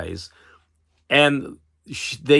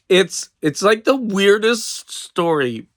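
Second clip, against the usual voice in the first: amazed; 8 semitones higher, 5 dB louder than usual. Real, not acted. Marvelling at the strange tale.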